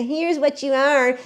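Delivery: superior, pointedly slow mock scolding; mock martyr superiority. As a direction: imitate someone lecturing from above. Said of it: "doing martyr superior stuff".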